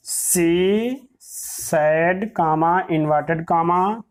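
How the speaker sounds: drawn-out words with long gaps; slow dictation. Shown as a demonstration. Teacher dictating an example sentence, slow dictation. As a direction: dictate it word by word, stretching each.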